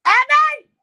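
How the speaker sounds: loud, high call across the house. Real, not acted. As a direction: shout the name to call it. Calling out to dog.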